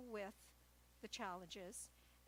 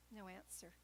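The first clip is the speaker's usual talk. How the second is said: usual speaking voice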